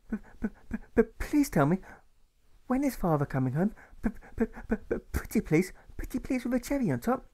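put-on a pleading little boy; stuttering boy. A narrator's reading. Alfred begs his mother for news, stammering.

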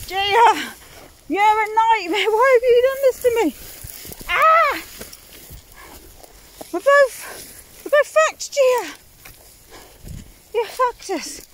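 rising despair, yelling at Gia; stuck in thistles, yelling at the dog in exasperation; exasperated yelling. Real, not acted.

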